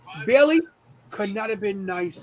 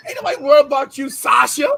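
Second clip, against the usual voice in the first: fast, loud, higher comeback; heated rapid clapback; retold telling-off; 4 semitones higher, 7 dB louder, faster than usual. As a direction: snap back at her hard and quick. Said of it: "I said".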